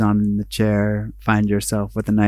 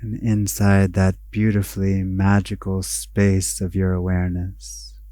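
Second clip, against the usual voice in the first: guided meditation; 6 dB quieter than usual. Acted. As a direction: guide them inward, unhurried, letting each phrase settle.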